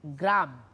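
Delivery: গ্রাম said alone, low, shut on the m; closed syllable. Shown as a demonstration. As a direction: stop the breath at the end of গ্রাম. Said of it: "গ্রাম বলেও কি হচ্ছে".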